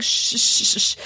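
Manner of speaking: sharp voiceless shushes; string of short shh sounds; retold shushing. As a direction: imitate someone hushing the room. Imitating her hushing to listen.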